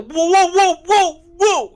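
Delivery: high, loud Brooklyn-guy "whoa"; imitating Joey. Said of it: "You know how Joy talks?".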